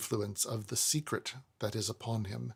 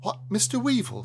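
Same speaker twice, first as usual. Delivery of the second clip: higher, mild timid stationer; a meek tradesman calling out; Snagsby voice; 9 semitones higher than usual. A narrator's reading. Narrator voices Snagsby greeting.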